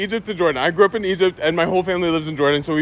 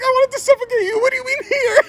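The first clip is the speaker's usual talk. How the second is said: laughing panicked mom. Retold or imitated.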